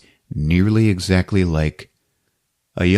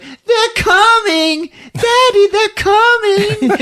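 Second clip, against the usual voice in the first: very high, slow, loud squeal of joy; excited kid shout; 4 dB louder, much slower than usual. Retold or imitated. Boy yelling to his daddy that the reindeer come, high joyful shout. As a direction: shout it like a thrilled little boy. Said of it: "He's like".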